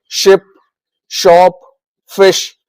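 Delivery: शिप, शॉप, फिश with a clear sh sound. Shown as a demonstration. Teacher giving SH examples, clear श in शिप, शॉप, फिश. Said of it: "इसको श बोला जाता है".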